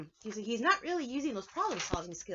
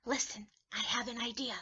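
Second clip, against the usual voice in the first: ordinary delivery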